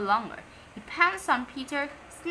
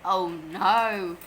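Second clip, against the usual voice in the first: ordinary delivery, nothing marked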